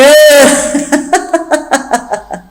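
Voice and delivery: a long helpless giggle; maid's giggle. Acted. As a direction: laugh in his face. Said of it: "Nicole n'arrête pas de rire".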